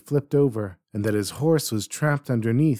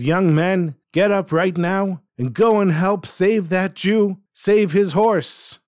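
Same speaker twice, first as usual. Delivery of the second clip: raised, higher insistent command; insisting; 7 semitones higher, 4 dB louder, slower than usual. A narrator's reading. Itchy urgently orders the boys to save the horse, insistent. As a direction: demand they go right now. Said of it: "he insisted".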